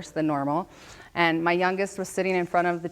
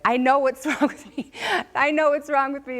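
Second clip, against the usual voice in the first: higher, louder, laughing I know; telling her husband on the phone, excited; excited discovery; 9 semitones higher, 4 dB louder than usual. Retold or imitated. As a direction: blurt it out with relief.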